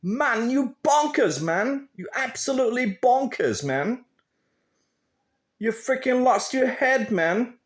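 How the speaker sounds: high, hammed-up English lad; mock English bloke telling him he's bonkers; English accent. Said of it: "I'm gonna switch to English".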